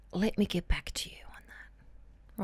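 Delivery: a soft, slow whisper, almost no voice; whispered stall. Shown as a demonstration. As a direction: murmur it like a secret way out. Demoing a stalling line to a boss.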